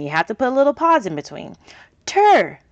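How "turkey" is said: Turkey said high and bright as one word; blended word. Shown as a demonstration. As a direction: sing out Turkey as the answer. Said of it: "Put that little pause in between".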